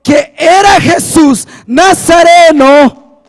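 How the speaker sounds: slow, loud pulpit proclamation; emphatic preacher cadence; full-force preaching. Real, not acted. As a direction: drive each phrase home, stretching the key words.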